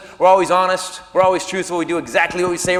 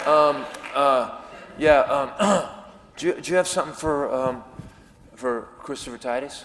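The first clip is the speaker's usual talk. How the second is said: low, soft, halting request; meek, hesitant mumble; sheepish asking; 5 semitones lower, 6 dB quieter than usual. Acted. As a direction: ask timidly with ums and throat-clearing. Sheepishly asks concierge for tickets.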